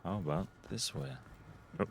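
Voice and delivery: ordinary talk, nothing marked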